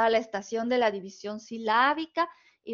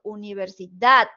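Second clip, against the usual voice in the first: "universidad" with a louder final DAD; stress on last syllable; 3 semitones lower, 5 dB louder than usual. Shown as a demonstration. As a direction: hit the end of "universidad". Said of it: "o con más intensidad".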